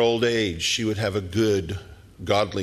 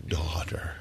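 whispered softly, gentle and loving; tender whisper; 4 semitones lower, 7 dB quieter than usual. Retold or imitated. Jesus tenderly addressing the healed woman, whispered. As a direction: breathe the word like a blessing. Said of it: "it was a tender word".

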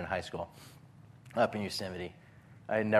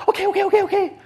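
high, loud, laughing okays; mock panicked giving up; 9 dB louder, much faster than usual. Brother imitates his little sister crying mercy in panic. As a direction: surrender fast like a scared kid.

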